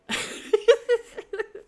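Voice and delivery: a burst of high, loud giggling; high squealing laugh. Real, not acted. Teller cracking up at her own story, high laugh.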